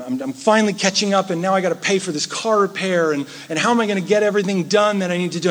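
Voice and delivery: fast, piling-up worried thoughts; anxious rush. Retold or imitated. Preacher voicing a worried believer to the church, rushed and anxious.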